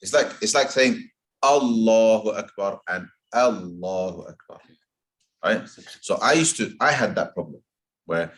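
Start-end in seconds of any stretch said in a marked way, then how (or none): none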